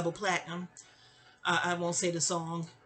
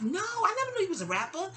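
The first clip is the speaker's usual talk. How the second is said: much higher, amazed; retold surprise; 11 semitones higher than usual. Told on the phone the cousin rapped, amazed. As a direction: pitch jumps in astonishment.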